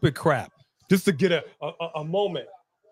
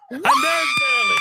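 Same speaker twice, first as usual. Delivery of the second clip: very high, thrilled shout; real fan eruption; excited shout. Real, not acted. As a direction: cry out as your favorite finally appears. Cheering a wrestler's surprise return.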